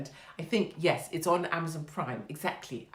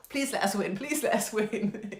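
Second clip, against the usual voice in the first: mock pleading; 6 semitones higher than usual. Fan begging a soccer god for a win, hammed-up pleading. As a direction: plead as if striking a bargain. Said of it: "these sort of bargaining things".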